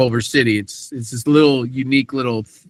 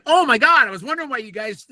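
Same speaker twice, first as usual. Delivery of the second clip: much higher, sudden oh-my-God; surprised realization; 9 semitones higher, faster than usual. Retold or imitated. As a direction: realize it all at once, pitch jumping up. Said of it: "I'm like".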